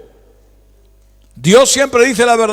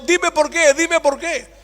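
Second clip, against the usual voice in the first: higher, begging plea; pleading Joshua; 8 semitones higher, slower than usual. Retold or imitated. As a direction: implore it over and over. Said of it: "él está pidiendo".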